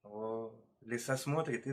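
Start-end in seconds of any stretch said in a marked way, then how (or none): none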